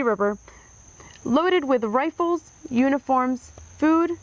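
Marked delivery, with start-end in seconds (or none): none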